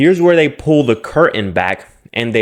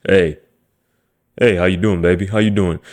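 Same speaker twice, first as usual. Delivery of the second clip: mocking a guy's lower put-on pickup voice; deeper smooth voice; 5 semitones lower than usual. Guy hitting on a woman, lowered mock-suave voice. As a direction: go low and smooth like a cheesy flirt. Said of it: "their voice gets a lot deeper".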